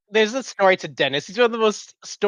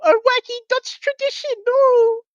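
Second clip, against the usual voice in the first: much higher, hammed-up fake Dutch accent; cartoon Dutchman, pitched way up; goofy Dutch voice; 4 dB louder than usual. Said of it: "be like".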